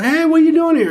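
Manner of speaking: high, loud surprised hail through the glass; surprised call through window. Retold or imitated. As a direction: call out, caught off guard at seeing her there. Neighbor knocks on window, calls in.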